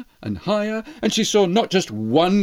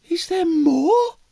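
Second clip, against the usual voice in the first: eager mouse; 9 semitones higher than usual. Acted. Mouse asks again.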